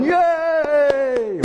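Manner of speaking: high, drawn-out cheer; crowd cheering. Acted. Pastor voicing crowds siding with Jesus, long high cheer. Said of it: "I'm sure they're going".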